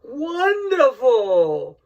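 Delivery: high, slow, drawn-out Broken Matt Hardy voice. Retold or imitated. Imitating Broken Matt Hardy for wrestling fans, stretched and high. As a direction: stretch it out in his odd sing-song. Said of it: "with his broken Matt Hardy character".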